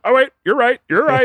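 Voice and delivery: high-pitched imitation of a sudden admission; mock concession. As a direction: play the other driver giving in, high and hammed-up. Mock concession from the other driver.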